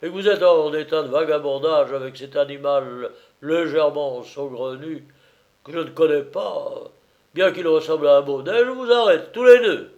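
loud gendarme building to a high shout; indignant officer, climaxing high and loud; terrible voice. A narrator's reading. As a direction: build to a thundering arrest. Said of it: "dit-il d'une voix terrible".

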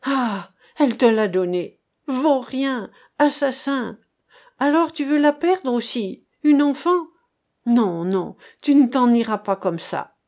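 wife raging at her husband; furious accusation. A narrator's reading. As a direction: tear into the husband. Mother accusing the father.

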